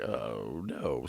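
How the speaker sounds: low, hushed sinking "oh no"; dread. Retold or imitated. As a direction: say it deep and soft, dreading what's coming. Dreading the confrontation behind him.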